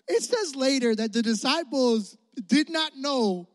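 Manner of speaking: ordinary talk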